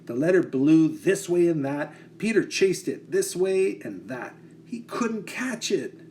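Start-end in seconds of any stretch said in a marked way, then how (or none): none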